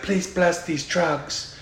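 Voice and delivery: slow, soft mock prayer; mock solemn plea. Friend quoting a movie character, slow mock prayer. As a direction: hush it like a silly blessing. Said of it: "this guy's like".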